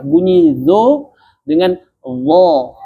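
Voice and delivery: "zo" then "wo" said slowly; teacher contrasting sounds; contrast demo. Shown as a demonstration. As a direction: compare "zo" and "wo" clearly, slowly. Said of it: "Beda nggak bunyinya?".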